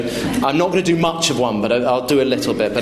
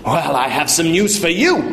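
raised-pitch haughty comeback; indignant father; 5 semitones higher than usual. Acted. Father retorts to refusing waiter, indignant. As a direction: announce it as a threat.

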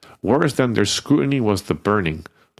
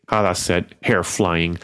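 ordinary delivery

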